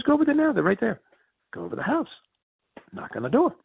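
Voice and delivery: urgent friend. Retold or imitated. Friend urging on phone.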